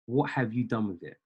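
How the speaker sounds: ordinary talk, nothing marked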